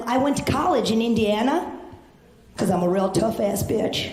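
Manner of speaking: normal talking voice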